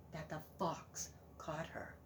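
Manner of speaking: unvoiced whisper; hushed suspense. A narrator's reading. Narrator telling children the fox caught her, whispering. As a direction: drop to a tense whisper. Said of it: "suspense narration".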